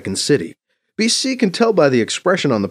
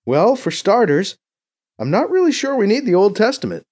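higher-pitched, hedging proposal; tentative BC; 12 semitones higher, 4 dB louder than usual. Acted. BC proposing cuts to Mbeke, tentative and high. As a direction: ease into a touchy suggestion, pitch raised.

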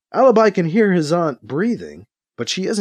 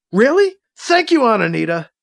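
delighted disbelief; 9 semitones higher, 4 dB louder than usual. A narrator's reading. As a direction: voice leaps high and loud with joy. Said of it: "Alibi can't believe it".

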